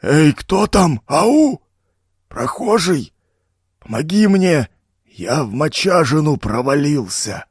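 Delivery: muffled, bubbling cry for help, high; a gurgling call from the bog; gurgling swamp voice. Acted. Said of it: "глухой булькающий голос".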